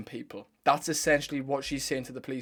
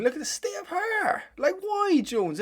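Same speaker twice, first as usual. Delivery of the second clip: exasperated. Real, not acted. Exasperated outburst at Jones.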